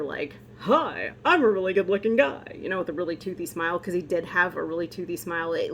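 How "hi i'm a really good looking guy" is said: cheesy good-looking guy voice. Retold or imitated. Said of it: "the Saturday Night Live character".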